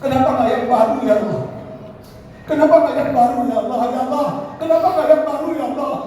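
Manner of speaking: high mock cry to God; retold dying cry. Dying husband calls to Allah as retold by wife, imitated cry. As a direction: cry it out high, without full shouting. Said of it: "sambil berteriak".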